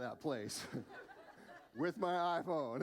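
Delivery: chuckling through the words; laughing. Real, not acted. Pastor laughs recalling crawling out of the store, real laughter. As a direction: laugh it out, embarrassed.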